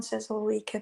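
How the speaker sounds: normal talking voice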